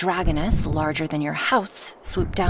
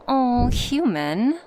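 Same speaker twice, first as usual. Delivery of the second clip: higher, louder, drawn out slowly; fond teasing; 6 semitones higher, 5 dB louder, much slower than usual. Acted. Dragoness to her worried human passenger, playfully chiding. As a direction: stretch it out, indulgently teasing.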